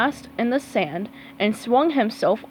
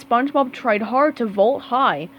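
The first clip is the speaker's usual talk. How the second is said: plain reading voice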